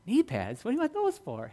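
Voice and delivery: puzzled question leaping high; mock bafflement. Preacher voicing his friend asked for knee pads, mock bafflement. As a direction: imitate a baffled friend, pitch jumping up.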